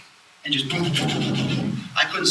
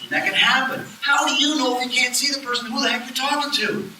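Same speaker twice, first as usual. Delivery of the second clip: ordinary talk, nothing marked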